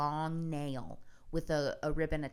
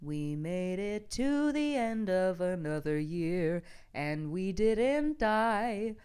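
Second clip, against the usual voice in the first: slow, rising to louder, higher laughing lament; mock melancholy; 4 semitones higher, 5 dB louder, slower than usual. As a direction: ham up holiday sadness until it cracks into laughter. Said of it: "it's also just like".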